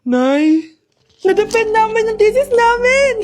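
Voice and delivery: loud, thrilled shout to mom; excited announcement. Acted. Son shouts the good news to his mother, thrilled. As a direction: burst in with the big news.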